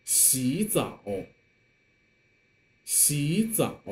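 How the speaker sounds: 洗 rising like a second tone before 澡; teacher's model of 洗 with rising tone; third tone changed to second. Shown as a demonstration. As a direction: lift 洗, then drop into third-tone 澡. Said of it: "some more examples that you can join".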